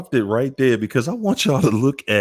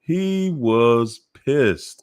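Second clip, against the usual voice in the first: slow emphasis; 5 semitones higher, much slower than usual. Real, not acted. Stressing attorney's anger.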